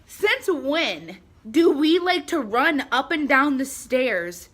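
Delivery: loud, high, outraged retort; indignant rant. Retold or imitated. Vlogger ranting at her neighbor Tammy, loud and outraged. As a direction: tell Tammy off, fed up. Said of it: "I was like".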